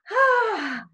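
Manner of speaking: high-pitched breathy "ah" of wonder; high gasp. Retold or imitated. Floating above people working on her, high gasp.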